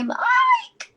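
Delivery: high sing-song call; lilting call for Mike. Acted. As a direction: call his name up high. Calling partner for attention.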